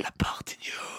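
chuchoté, loin du micro; voix lointaine, éloignée du micro. Pretend. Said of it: "Je m'éloigne du micro". (loin du micro, en chuchotant)

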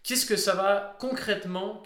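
ordinary talk, nothing marked

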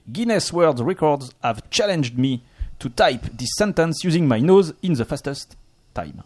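slow, deliberate French-accented English; super English accent. Shown as a demonstration. Host showing off his super accent to the panel, French-accented English. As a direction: read it in proudly overdone French-accented English. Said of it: "mon super accent".

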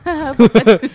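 nervous laugh. Real, not acted. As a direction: giggle through it nervously. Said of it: "j'ai un rire nerveux". (rire nerveux)

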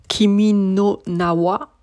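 "Kimi no Na wa" said slowly, syllable by syllable, in careful Japanese; tentative attempt at Japanese; unsure Japanese pronunciation. Shown as a demonstration. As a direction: sound out "Kimi no Na wa" cautiously, unsure of each sound. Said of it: "Je sais pas du tout la prononciation".

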